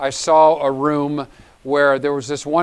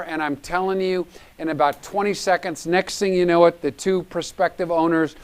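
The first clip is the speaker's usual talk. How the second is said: ordinary talk, nothing marked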